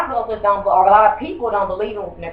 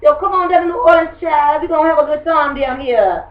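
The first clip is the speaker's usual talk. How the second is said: drawn-in, welcoming New Orleans accent, lifted higher; louder, higher New Orleans accent as it builds; 6 semitones higher, 3 dB louder than usual. Shown as a demonstration. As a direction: imitate a New Orleans local calling folks to visit. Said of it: "have a certain kind of accent".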